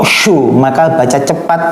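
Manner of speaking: normal talking voice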